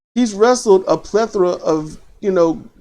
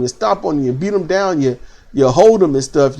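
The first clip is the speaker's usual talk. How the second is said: ordinary talk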